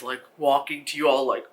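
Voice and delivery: ordinary delivery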